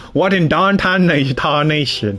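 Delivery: very slow southern accent on "What in darn tarnation?". Shown as a demonstration. Streamer showing how he gets into the voice, slow southern accent. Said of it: "you know what I think in my head?".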